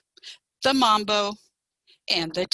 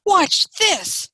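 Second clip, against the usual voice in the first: high, loud boast; showy announcement; 11 semitones higher, 6 dB louder than usual. A narrator's reading. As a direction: announce it big, showing off. Fox shows off to chickens.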